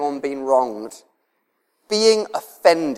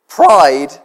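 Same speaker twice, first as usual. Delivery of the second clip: ordinary talk, nothing marked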